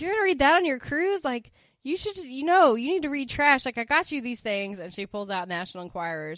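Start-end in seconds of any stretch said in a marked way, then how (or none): 0.0-4.8 loud, high-pitched disbelief in a retold quote; emphatic, raised-voice mock lecture; mock scolding. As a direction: act out someone scoffing at a friend's choice. Acted scolding quote from the show.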